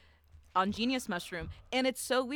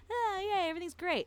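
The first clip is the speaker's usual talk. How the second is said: mock bubbly cheer; 11 semitones higher than usual. Mocking her own cheerful self.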